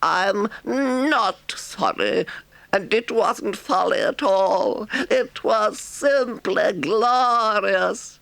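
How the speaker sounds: high, slow, defiant Toad voice; stubborn glee. A narrator's reading. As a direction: savour the word glorious.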